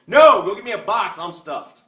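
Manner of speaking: high, loud stuffed diner; loud refusal. Retold or imitated. Diner refusing dessert to waitress, loud and high. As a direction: blurt it out, full and done. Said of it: "you go".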